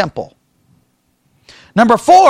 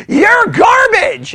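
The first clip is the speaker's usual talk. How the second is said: high, slow scornful shout of garbage; mock contempt; 11 semitones higher, 3 dB louder, much slower than usual. Imitating critics insulting him, slow high scornful shout. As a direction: spit out the insult in mock disgust. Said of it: "they're gonna be like".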